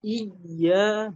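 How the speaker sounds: "Iyya" held with pressure on the doubled y; pressed ya. Shown as a demonstration. Teacher shows the stress, pressed "Iyya". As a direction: lean into the y of "Iyya". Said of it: "diberikan tekanannya".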